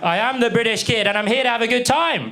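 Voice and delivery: high, emphatic self-introduction; hammed-up British kid. British exchange kid introducing himself to host family, mock cheer.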